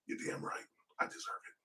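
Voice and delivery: hushed confession to the viewers; whispered aside. Real, not acted. Admitting to viewers he'd take the money, whispered. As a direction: whisper it like a secret. Confiding about alimony.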